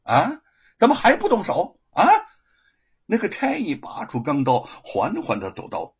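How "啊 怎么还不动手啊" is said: high, loud, impatient bellow; shouted demand. A narrator's reading. Magistrate to the hesitating constable, impatient shout. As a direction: demand action at full voice. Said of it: "知县喝道".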